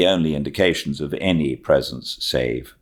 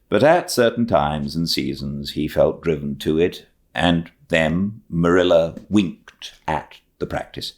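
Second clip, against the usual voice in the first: plain reading voice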